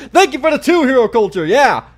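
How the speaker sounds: excited thanks. Real, not acted. Thanks a donor right after celebrating.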